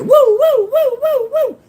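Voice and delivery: very high, loud whooping mock calls; hunters' calls. Mob guys calling through the cemetery, high loud whoops. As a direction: whoop like the mob searching the dark. Mobsters hunting them, calling out.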